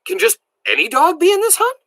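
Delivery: very high, squeaky question; strained croak. A narrator's reading. Billy overcome by excitement asking about the hunt, high croak. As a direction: force it out high, barely able to speak. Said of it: "I croaked".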